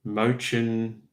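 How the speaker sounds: Mönchengladbach sounded out in a mock German way; clumsy German attempt. Shown as a demonstration. Streamer jokingly attempts a German town name, mock German pronunciation. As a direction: have a go at Mönchengladbach in German, knowing it's bad. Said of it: "I'm gonna try 'cause it'll just be funny".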